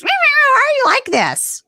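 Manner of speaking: very high, drawn-out mocking whine; exaggerated high-pitched taunt, stretched out; mocking imitation. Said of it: "makes fun of her".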